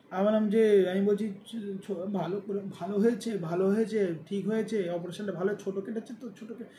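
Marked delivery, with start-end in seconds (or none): 1.5-6.7 softer and softer, words dying away; straining to speak, barely getting it out; weak groggy mumble. Retold or imitated. Just out of anaesthesia, telling family it went well, faint fading mumble. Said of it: "এটা বলছি তো কাউকে প্রকাশ করতে পারছি না".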